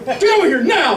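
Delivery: high-pitched commanding dad yell; hammed-up dad voice, urgent and high. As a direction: imitate a father ordering his kid over. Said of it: "I have dad voice".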